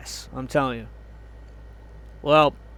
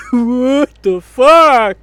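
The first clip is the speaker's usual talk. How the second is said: loud, drawn-out cry of disbelief; exasperated outburst; 5 semitones higher, 9 dB louder, much slower than usual. Real, not acted. Ranting about Superman not being strong enough, exasperated. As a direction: stretch it out loud, half laughing.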